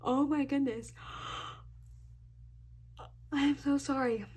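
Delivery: apologizing through a yawn. Real, not acted. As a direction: yawn while you speak. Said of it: "and now I'm yawning".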